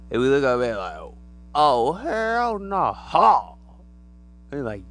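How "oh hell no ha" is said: drawn-out mock shock ending in a laugh; shocked reaction. Person staring up at a giant alien, slow mock shock. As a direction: stretch it out in disbelief.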